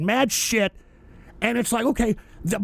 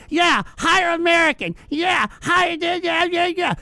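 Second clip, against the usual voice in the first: mocking loudmouth; 5 semitones higher, 3 dB quieter than usual. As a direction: parody them higher and sneering. Mocking loud MAGA types.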